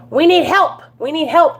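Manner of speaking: rally your viewers to pitch in; retold plea, voice lifted; urgent appeal.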